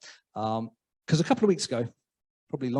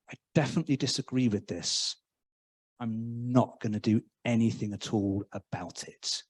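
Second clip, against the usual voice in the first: mock-timid British bystander, fading softer; quietly disapproving to yourself, trailing away; timid mutter; 6 semitones lower, 6 dB quieter than usual. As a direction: bystander grumbling under breath, getting softer.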